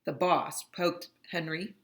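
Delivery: ordinary delivery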